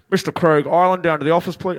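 loud, low-pitched official call; office summons. Acted. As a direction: summon a pupil over the whole room.

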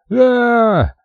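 long, high-pitched battle yell; narrator's sustained high scream; furious war cry. A narrator's reading. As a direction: hold a high "aaa" as a charge cry. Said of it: "с яростным воплем".

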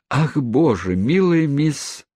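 high, drawn-out cry of dismay; mock-horrified chorus exclamation; exclaiming. A narrator's reading. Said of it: "воскликнули остальные двадцать девять воспитанниц".

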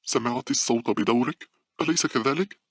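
high-pitched frightened question; trembling with fear. A narrator's reading. Paula asks if her friend heard the laugh, trembling. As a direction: seek confirmation in an alarmed high voice. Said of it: "قالت باولا بصوت مرتعد".

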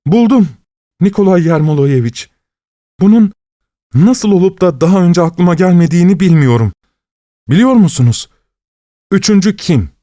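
eager detective bursting with his revelation; excited exclamation. A narrator's reading. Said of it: "diye bağırdı".

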